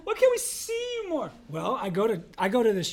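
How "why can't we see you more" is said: whiny plea. Retold or imitated. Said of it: "Mom, Dad".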